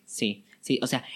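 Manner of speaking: usual speaking voice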